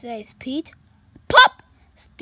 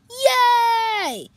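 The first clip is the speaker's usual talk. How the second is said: higher, louder cheer; 10 semitones higher, 10 dB louder than usual. Acted. Friends cheering at the full rainbow. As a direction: whoop for joy. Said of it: "Pete and Stevie cheered".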